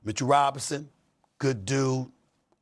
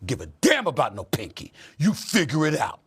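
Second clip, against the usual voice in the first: ordinary talk, nothing marked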